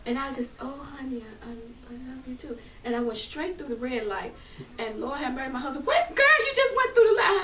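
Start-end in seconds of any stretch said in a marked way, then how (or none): 0.6-2.6 soft, loving murmur to a husband; tender. Retold or imitated. Repeats her loving reply to husband. 5.9-7.4 high, loud yell imitating a panicked husband; alarmed yell. Husband's alarmed yell at red light.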